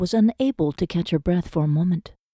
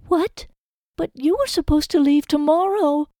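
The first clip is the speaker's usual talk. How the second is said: put-on high, stunned young woman; Lizzie's voice; 11 semitones higher than usual. A narrator's reading. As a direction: raise the voice to a shocked Lizzie.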